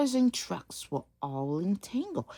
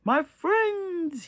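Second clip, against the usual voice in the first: loud high orator voice; mayor opening a speech; mayor's speech; 11 semitones higher, 5 dB louder than usual. Acted. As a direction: address the crowd grandly.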